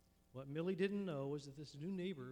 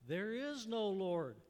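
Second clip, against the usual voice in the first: higher, louder, slow yelled retort; screaming back; 4 semitones higher, 7 dB louder, slower than usual. Acted. Atheist neighbor screams back at Millie over the fence. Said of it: "the neighbors screaming back".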